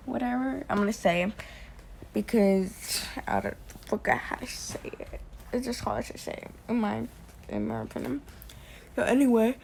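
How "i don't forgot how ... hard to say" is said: whispered. Real, not acted. Embarrassed trailing confession.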